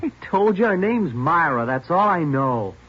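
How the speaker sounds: fed up, repeating himself; loud, drawn-out exasperation; exasperated insistence. Acted. Suspect exasperated under interrogation.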